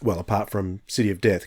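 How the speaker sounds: ordinary talk, nothing marked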